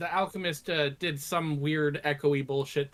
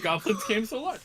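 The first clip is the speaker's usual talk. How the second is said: laughing; 7 semitones higher than usual. Real, not acted.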